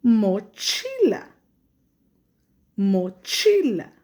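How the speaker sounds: "Mochila" said slowly and loudly; teacher slowly says "Mochila" for the class; slow clear model word. Shown as a demonstration. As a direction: say it so the kids can hear it. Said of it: "tiene el sonido".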